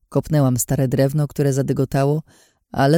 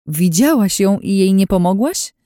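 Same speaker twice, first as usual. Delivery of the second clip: raised, louder reproachful question; nervous edge; 5 semitones higher, 5 dB louder than usual. A narrator's reading. Friend reproaching Anna for not helping, nervous edge. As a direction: question her with agitated disbelief. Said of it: "Wyczułam nerwową nutę w jej głosie".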